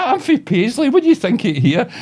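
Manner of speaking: high, loud old-lady impression; wee old woman. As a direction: imitate a chatty old woman, pitched well up. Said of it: "this wee old woman".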